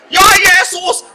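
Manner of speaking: high, loud, drawn-out mock invocation; hammed-up fervent prayer. Preacher recalling praying hard over people, hammed-up fervour. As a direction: stretch out a strained, high cry of prayer. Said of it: "Saya itu sampai mendoakan".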